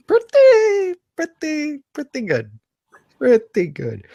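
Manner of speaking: high drawn-out comic pretty good; mock pretty good. Host joking about his player's huge game, hammed-up high voice. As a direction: stretch each pretty way up high.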